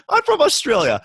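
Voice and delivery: high-pitched hammed-up Aussie; mock Australian accent. Parodying how Australians introduce themselves, mock Australian accent. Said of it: "That's what Australians naturally, first thing they say".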